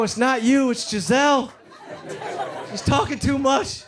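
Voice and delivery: high, slow mock breakup. Dumping his girlfriend, mock serious. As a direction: dump her with a straight face.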